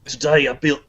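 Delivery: put-on lightly done Australian accent. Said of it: "you could do it in an Australian accent".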